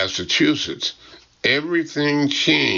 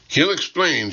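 interrupting secretary; 7 semitones higher than usual. A narrator's reading. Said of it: "she interrupts".

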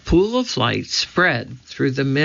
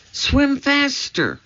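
urgent; 13 semitones higher, slower than usual. A narrator's reading. Urgent warning in the dark.

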